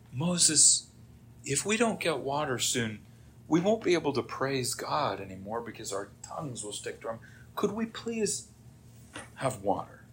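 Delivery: softly voiced, timid request; mock meek plea. Israelites politely asking Moses for water, mock meek plea. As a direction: ask politely and softly, as if parched.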